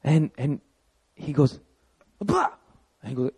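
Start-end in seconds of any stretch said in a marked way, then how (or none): 2.2-2.5 put-on much higher, bright child's call of Abba; little boy calling. Toddler greeting his dad at the door, high happy call.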